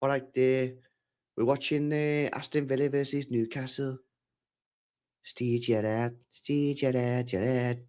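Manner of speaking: hammed-up Scouse impression; put-on Scouse accent. Shown as a demonstration. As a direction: show off a mock Liverpool accent. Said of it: "Speak Scots".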